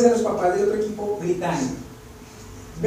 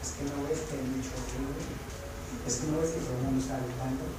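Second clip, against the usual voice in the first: hushed, much softer, hurried explanation; whispering to wife; 6 semitones lower, 12 dB quieter, faster than usual. Retold or imitated. Father telling his wife why he won't shout, hushed and softer. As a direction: keep it under the noise. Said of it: "y le digo".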